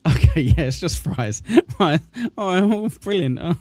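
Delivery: chuckling through the words; tickled, laughing reaction; amused laughter. Real, not acted. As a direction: let laughs break up the reply. Host laughing at the answer.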